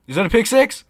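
high, loud, hopeful question; watching live, voice leaping up; real excited outburst. Real, not acted. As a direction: ask it in rising excitement at the play. Live reaction to televised interception.

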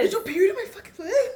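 high, loud quoted outcry; mock outrage. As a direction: act out an appalled accusation with a laugh in it. Acting out outraged movie quote.

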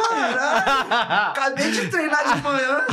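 laughing through the words; cracking up mid-sentence. Real, not acted. Retelling being dropped, laughing.

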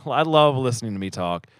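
ordinary delivery

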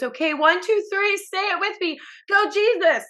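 high cheery cheerleader count-in; imitating her mom. Daughter imitates her mom leading a chant, very high. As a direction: lead a chant like an excited new believer. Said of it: "And then she goes".

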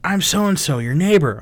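retold neighbor's introduction, lightly voiced; neighbor woman. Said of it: "And she goes".

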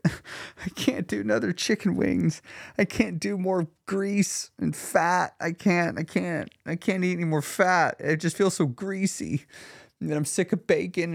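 replayed pleading grumble; fed-up complaint. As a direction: imitate yourself utterly sick of the diet. Replays his past self despairing over keto.

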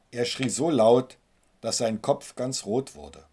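ordinary delivery

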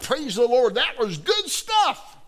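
high, loud shout that builds to the end; acted-out hallelujah enthusiasm, last words drawn out; exuberant praise. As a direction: holler it like you loved the song.

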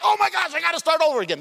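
high, startled mock exclamation; a hammed-up realisation that you have to start over; mock panic. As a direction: gasp it out high, as if caught drifting. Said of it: "And then you're like".

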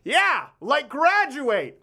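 imitating dad heckling the movie; dad yelling. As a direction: heckle the screen. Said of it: "my dad yelled out".

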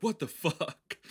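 high, loud mock shock; hammed-up yelp at a sudden interruption; startled outburst. Acts startled reaction to a voice.

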